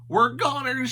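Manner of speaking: groaning Shaggy. A narrator's reading. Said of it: "Shaggy groaned".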